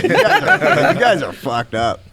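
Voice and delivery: laughing through the words. Retold or imitated. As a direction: laugh while calling them crazy. Brother laughing at the walkout.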